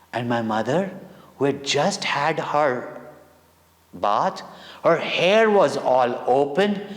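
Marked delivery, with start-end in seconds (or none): none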